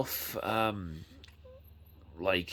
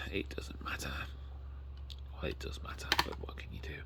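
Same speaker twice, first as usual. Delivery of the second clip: whispered resigned aside; a sotto voce shrug about the dented case; 5 semitones lower, 8 dB quieter, faster than usual. Real, not acted. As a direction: breathe it to yourself, giving up. Muttered aside about damaged box.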